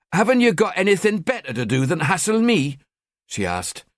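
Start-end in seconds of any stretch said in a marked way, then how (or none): 0.1-2.8 put-on high, annoyed Newcastle teen; northeastern accent. Girl to fake cop, high annoyed Newcastle voice. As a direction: grumble at him in her Geordie accent. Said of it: "her accent increasingly annoying".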